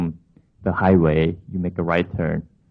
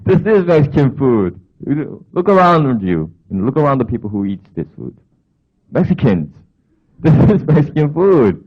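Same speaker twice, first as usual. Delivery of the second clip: mock upset; 6 semitones higher, 6 dB louder than usual. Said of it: "And I was really upset".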